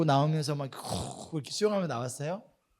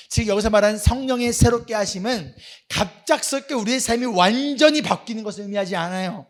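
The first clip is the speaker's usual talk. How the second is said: louder, raised preaching voice; 6 semitones higher, 10 dB louder than usual. Acted. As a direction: build the sermon point with force. Preaching the point with force.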